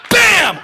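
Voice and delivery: high, very loud "bam"; imitated smash. Iggy destroys a flower pot, shouted sound effect. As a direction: explode on the word like a kick.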